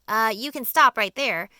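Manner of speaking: a loud, curt cut-off; sharp. A narrator's reading. Earthworm stopping James mid-plan, sharp and loud. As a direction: shut the talk down sharply. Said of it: "the earthworm said sharply".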